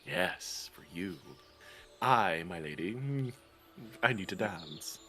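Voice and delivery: seductive low murmur. Acted. Flirting with tabaxi guard.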